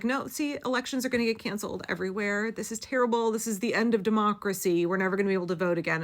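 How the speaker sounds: high, fast, panicky whine; mock hysteria, high and rushed; mock panicked commenter. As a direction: squeal it like a doomsaying commenter. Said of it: "people were still in my comments being like".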